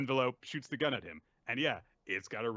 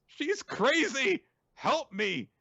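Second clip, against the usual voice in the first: high, loud cry for help; acted desperate yelp; mock panic; 5 dB louder, slower than usual. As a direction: plead in a hammed-up, high panicked voice. Beaten character begs for help.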